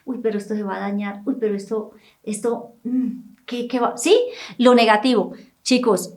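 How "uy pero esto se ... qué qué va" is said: quick, hushed worried muttering to yourself; quiet nervous inner voice; anxious inner thought. Retold or imitated. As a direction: voice the fearful doubt, soft and rushed. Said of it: "viene el, el pensamiento".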